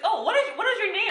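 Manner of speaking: high, sweet, suddenly friendly voice with a laugh; imitating the hairdresser. The hairdresser suddenly warming up, high and sweet. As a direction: switch on the charm as she imitates her. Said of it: "she's like".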